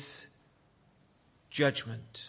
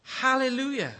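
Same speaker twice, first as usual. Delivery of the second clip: shouted hallelujah; 7 semitones higher than usual. Retold or imitated. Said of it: "Why do people shout".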